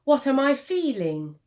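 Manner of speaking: usual speaking voice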